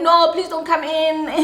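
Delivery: high, whimpering fake plea; mock pleading. Telling him not to come in, mock pitiful begging.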